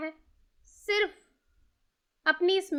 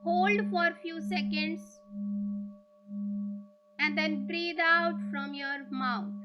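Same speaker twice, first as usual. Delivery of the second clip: guided meditation. Real, not acted. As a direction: ease them into stillness, leaving room to breathe.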